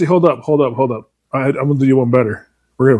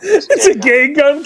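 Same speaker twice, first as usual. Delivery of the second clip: repeating Caitlin; 10 semitones higher, much slower than usual. Retold or imitated. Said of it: "Caitlin says".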